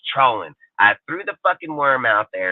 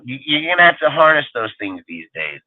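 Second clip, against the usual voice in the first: ordinary delivery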